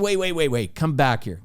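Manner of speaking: high, hurried plea to wait; amused calling back. Retold or imitated. Golfer calling back his departing caddy, amused. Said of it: "he can't stop laughing".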